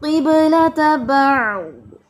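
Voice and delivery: किबलत बअद very slow and loud, full ending; correct recitation. Shown as a demonstration. Teacher giving the correct version after the wrong one, slow. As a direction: stretch किबलत बअद fully. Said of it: "ऐसे नहीं पढ़ेंगे हम".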